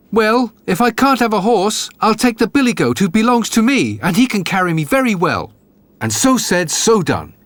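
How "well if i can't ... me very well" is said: high, eager voice of a simple lad; young fool hailing his brothers, higher; Jack the Dullard. A narrator's reading. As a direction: call out cheerfully to the brothers, pitched up. Jack answers father.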